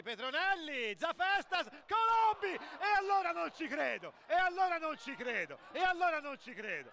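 high shout then stunned repeats; excited disbelief. Real, not acted. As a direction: cry out in disbelief. Big chance, disbelief.

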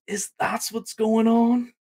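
high, slow, put-on surprise; acting surprised. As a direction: ask it with exaggerated astonishment. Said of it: "Acting all surprised".

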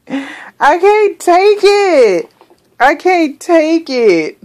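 slow, high, swooning over cuteness; high drawn-out cooing; high squealing gush. Real, not acted. Overwhelmed by cuteness.

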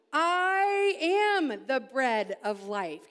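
very slow, stress on am; I am the bread of life, each word spaced and weighted; slow proclamation. Shown as a demonstration. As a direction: proclaim it like a mic drop. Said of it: "this is like his mic drop moment".

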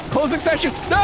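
high shocked cry as the game closes; alarmed shout. Real, not acted. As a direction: yell out in real dismay. Game crashes, host left.

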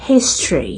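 "history" as HIS-tree, low and falling; "history" stressed first, middle vowel dropped; English history. Shown as a demonstration. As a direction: say "history" in two syllables. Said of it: "บ่แม่น history เด้".

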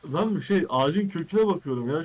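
flustered. Real, not acted. Caught, defensive excuse.

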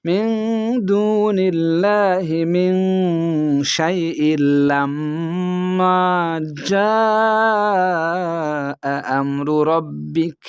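slow, drawn-out melodic tilawat; Quran recitation. Shown as a demonstration. Teacher reciting the verse for students, slow melodic chant. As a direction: chant each word long and sustained.

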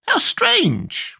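very high squeaky creature; high-pitched squeaky character; Gump's squeaky voice. A narrator's reading. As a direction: squeak it out, far above your own voice. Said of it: "remarked the Gump".

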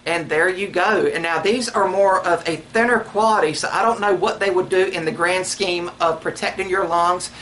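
muffled through cloth mask; speaking behind fabric covering; talking through a face mask. Real, not acted.

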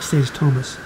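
ordinary delivery, nothing marked

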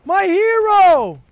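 slow, high, loud mock adoration; drawn-out hero cheer. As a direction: stretch it out like a fan.

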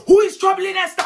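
loud, high-pitched demand; mock indignant shout. Auntie comes to Esther's defence, shouting indignantly. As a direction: burst in shouting as the protective aunt.

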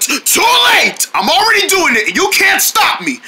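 high, crowing taunt; play-acted defiance. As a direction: crow it high over their protests.

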